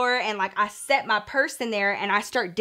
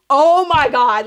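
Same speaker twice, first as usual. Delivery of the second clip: retold outburst; 3 semitones higher, 7 dB louder, slower than usual. Bursts out once elevator closes, retold.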